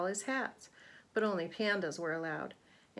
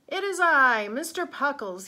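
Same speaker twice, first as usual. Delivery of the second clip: high, loud heroic announcement; grand announcement; 6 semitones higher, 10 dB louder than usual. A narrator's reading. Mister Pockles announcing himself to save the day, loud and high. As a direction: proclaim it like a hero. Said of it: "he announced".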